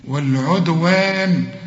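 والعدوان slow, with a full long alif after the waw. Shown as a demonstration. Teacher modelling the long alif for the student. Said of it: "وبعد الواو الألف".

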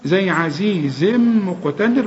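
ordinary talk, nothing marked

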